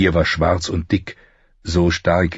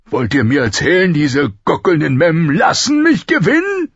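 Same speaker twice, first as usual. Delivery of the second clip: high, raised, furious question; outraged king; 10 semitones higher, 4 dB louder than usual. A narrator's reading. King learning his opponents would let him win, outraged. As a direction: demand it in rising outrage. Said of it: "mit puterroter Miene".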